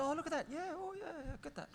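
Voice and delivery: high, eager, fading to a murmur; enthusiastic older brother. Retold or imitated. Brother warming to the model, high and eager. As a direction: gush approvingly, then trail off quietly. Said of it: "He said".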